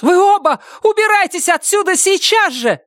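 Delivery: very high urgent scream; shouting. A narrator's reading. Доркас ordering them both away, shouting. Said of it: "закричала Доркас".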